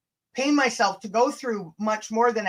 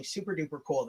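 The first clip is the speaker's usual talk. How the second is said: self-mocking mutter; 6 semitones lower, 11 dB quieter than usual. Real, not acted. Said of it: "That sounded super duper lame".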